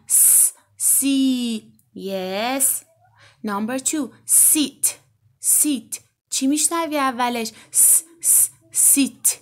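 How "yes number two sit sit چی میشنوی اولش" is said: ordinary talk, nothing marked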